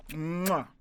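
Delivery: smack out an approving air kiss; exaggerated kiss sound of approval; chef's kiss. Shown as a demonstration. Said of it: "chef's kiss".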